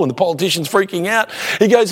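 ordinary delivery

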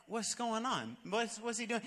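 muttering to self. Retold or imitated. Pastor watching from a distance, quiet puzzled aside. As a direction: wonder quietly what he's up to. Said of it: "I'm like".

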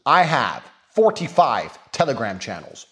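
normal talking voice

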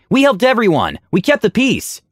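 high-pitched, insistent protest; narrated shout. A narrator's reading. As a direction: defend yourself with a lifted voice. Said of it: "Aura shouted".

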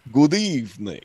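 lightly voiced hammed-up vampire; a hint of a bad Dracula, fangs and cape; cheesy vampire greeting. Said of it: "in an opera cape and bad fangs going".

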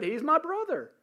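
high mock quote of Sarah. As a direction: mimic her lie in a high voice. Mocking Sarah's lie.

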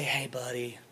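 quiet, breathy parent whisper. Retold or imitated. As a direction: whisper the bad news to a groggy child. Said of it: "And they say".

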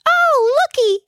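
very high, eager comic exclamation; high-pitched delighted call; excited Gnesi exclamation. A narrator's reading. Gnesi spots an old lady from the car, high and excited. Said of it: "exclaimed Gnesi".

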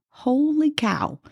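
slow, drawn-out holy cow, half under the breath; amazed aside. Real, not acted. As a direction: let the wonder stretch it out. Marvelling at thirty years passing.

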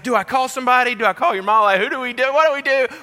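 high, loud string of frantic questions; panicked wife. Retold or imitated. Wife panics over sick husband.

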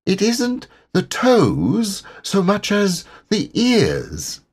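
high, slow and serious little voice; solemn Piglet. Acted. Piglet solemnly answering Pooh, high and slow. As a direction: deliver it like a grave complaint. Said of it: "he said solemnly".

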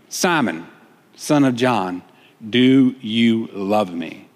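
low, slow, weighty question; solemn asking. Acted. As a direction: drop the pitch and draw out each word gravely. Jesus asks Peter a third time.

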